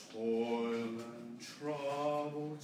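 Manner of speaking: toil and trouble with toil and tra big, and thrown away; slow, weight on toil and tra only; phrasing demo. Shown as a demonstration. Choir director demonstrating which syllables of toil and trouble carry weight. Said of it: "Toil is big, tra is big".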